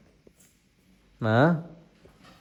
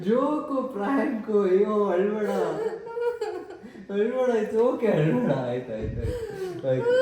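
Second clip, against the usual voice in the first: laughing; 10 semitones higher than usual. Real, not acted. Son confesses prank, laughing.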